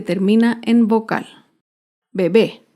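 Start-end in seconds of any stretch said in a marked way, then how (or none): 2.1-2.7 low, firm "Bebé", be-BÉ; stress on last syllable. Shown as a demonstration. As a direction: hit the final BÉ of "Bebé". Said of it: "Se acentúa en la última sílaba".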